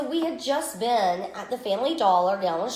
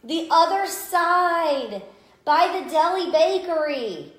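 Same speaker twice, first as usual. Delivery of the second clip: a hint of a knowing woman, slow and higher; helpful stranger; 5 semitones higher, 4 dB louder, slower than usual. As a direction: point the way, slow and drawn out. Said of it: "she goes".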